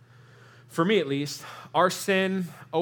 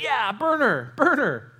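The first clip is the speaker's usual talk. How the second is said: higher, louder jeering crowd voice; mocking mob cry; 9 semitones higher, 4 dB louder, slower than usual. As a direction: egg on the burning like a jeering crowd. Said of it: "people are like".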